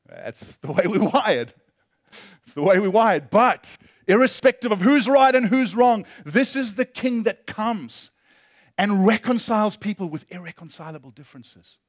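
loud, high preaching that swells; preaching at full force. Acted. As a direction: preach it out, building to a peak.

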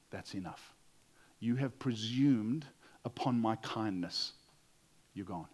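low, deliberate firing of an employee; firm quiet dismissal. Retold or imitated. Wise boss firing the employee.

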